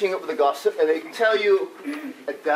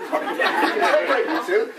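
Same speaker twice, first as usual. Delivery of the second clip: laughing; 2 semitones higher than usual. Retold or imitated. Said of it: "never want to say".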